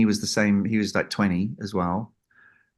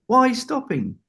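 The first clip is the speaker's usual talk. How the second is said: much higher demanding question; puzzled John; 14 semitones higher than usual. Retold or imitated. Storyteller voices John asking why he stopped, high. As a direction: imitate John's impatient query, pitched way up. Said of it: "he goes".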